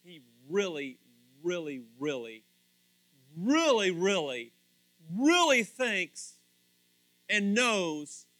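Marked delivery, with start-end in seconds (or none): 0.0-6.3 "really" repeated, each slower and building louder; building emphasis. Real, not acted. Preacher telling listeners how much he loves them, building emphasis. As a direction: punch and stretch each "really", then ease and hit again.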